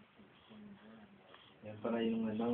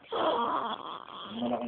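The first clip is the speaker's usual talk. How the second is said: high voice; much slower than usual. Real, not acted. Odd high voice.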